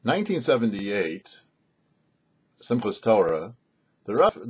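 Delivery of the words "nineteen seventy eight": plain reading voice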